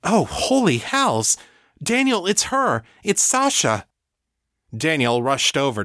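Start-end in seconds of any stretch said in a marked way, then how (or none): 0.0-3.9 high-pitched astonished outburst; cry of alarm. Acted. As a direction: blurt it out in shocked recognition. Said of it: "Danny gave a cry of alarm".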